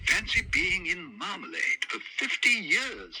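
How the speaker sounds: exclaimed. Acted. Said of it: "he exclaimed".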